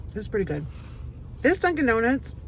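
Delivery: talking while chewing; mouth full. Real, not acted. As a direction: speak round a mouthful of food. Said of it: "I'm talking with my mouth full".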